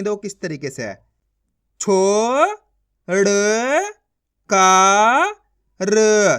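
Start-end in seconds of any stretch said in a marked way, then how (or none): 1.8-6.4 छो, ड़, का, र held long with pauses; direct syllable reading. Shown as a demonstration. Teacher reading the matra directly, sounding out. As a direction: sound each syllable alone, का loud. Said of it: "direct मात्रा का उच्चारण करके पढ़ेंगे".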